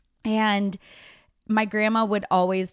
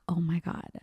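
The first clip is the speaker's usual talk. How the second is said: awed, barely voiced; stunned whisper; 6 dB quieter than usual. Realizing her dog Hank is Henry, whispered. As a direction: breathe it out in a hushed whisper.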